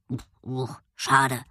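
low, soft doggy grumble; grumbling dog. A narrator's reading. Dog stopped from exploring, grumbling low. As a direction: grumble with little disappointed woofs, pitched low. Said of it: "Bonsai blieb stehen und grummelte".

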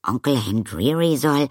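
high, loud astonishment; surprised. A narrator's reading. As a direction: blurt it out in surprise. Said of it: "fing Arrietty überrascht an".

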